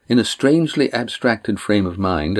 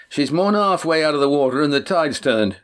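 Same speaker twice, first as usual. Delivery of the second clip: shouting across water; 6 semitones higher than usual. A narrator's reading. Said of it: "Cam called from the shore".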